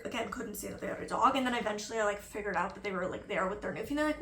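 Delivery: ordinary talk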